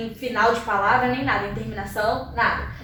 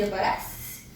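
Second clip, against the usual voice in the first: whispered aside; 6 semitones lower, 10 dB quieter, slower than usual. Real, not acted. Whispered question to cameraman.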